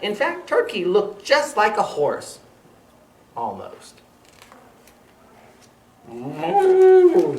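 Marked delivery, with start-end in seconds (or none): 6.1-7.4 high, loud mock moo; cow moo. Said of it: "said the cow".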